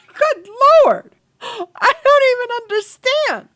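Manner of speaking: high, loud, laughing exasperation; real laugh-through at something absurd; cracking up at a singing game character; laughing disbelief. Real, not acted.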